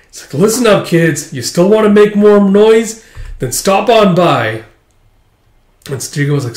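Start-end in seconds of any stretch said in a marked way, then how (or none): 0.4-4.6 high, loud showman's call; rally the kids in a big voice; boisterous master. Acted. Master invites noisy kids to stop by, boisterous.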